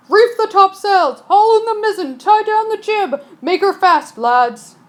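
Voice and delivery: roared. Acted. Captain roaring orders to his crew. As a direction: bellow over the storm. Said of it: "he roared".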